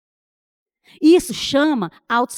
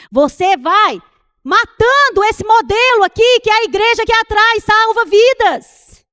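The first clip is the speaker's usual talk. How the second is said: louder, higher preaching, slowing at the end; forceful preaching; 7 semitones higher, 7 dB louder than usual. Acted. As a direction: drive the warning home from the pulpit. Preaching builds to full force.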